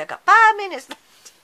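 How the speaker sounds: sudden loud, high burst; alarmed exclamation. Real, not acted. Card maker realising the video time is nearly gone, alarmed. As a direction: blurt it out in startled alarm.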